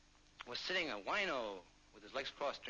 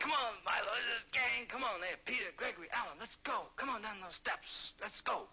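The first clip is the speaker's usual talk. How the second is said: higher, urging call; rally your friends down the stairs; calling the gang; 6 semitones higher than usual. Acted. Calls the gang to leave.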